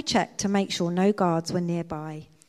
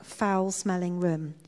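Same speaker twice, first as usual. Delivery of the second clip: plain reading voice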